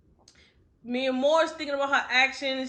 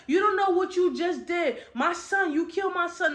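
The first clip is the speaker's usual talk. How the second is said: higher-pitched retold fury; furious mother; 6 semitones higher than usual. Retelling a kidnapped mother confronting her captor, mock fury. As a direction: imitate a mother's angry accusation with a raised pitch. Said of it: "she is furious with Murder".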